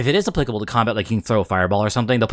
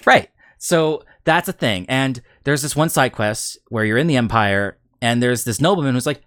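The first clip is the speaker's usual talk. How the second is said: ordinary talk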